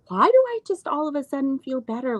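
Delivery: sudden wonder bursting out, hurried; asking herself in disbelief, high and quick; surprised. Retold or imitated. Retold sudden relief realization.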